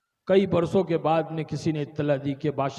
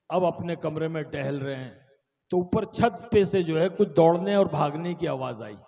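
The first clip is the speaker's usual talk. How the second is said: ordinary talk, nothing marked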